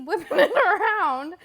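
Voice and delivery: words breaking into real laughter; laughing. Real, not acted. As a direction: crack up mid-sentence. Laughing at the stick story.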